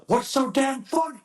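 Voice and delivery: high-pitched mock yell; imitated angry instructor. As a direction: holler it like an outraged drill instructor. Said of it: "the big angry man".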